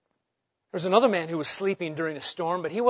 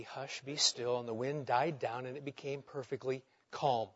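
much softer, low and calm; hushed calm; 6 semitones lower, 12 dB quieter than usual. Acted. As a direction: let the storm go silent. Jesus calms the sea.

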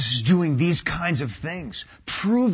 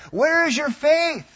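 raised high and loud; strong challenge; 10 semitones higher, 6 dB louder than usual. Retold or imitated. Preacher voicing Jesus challenging the terrified disciples, loud. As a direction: fire the question at them.

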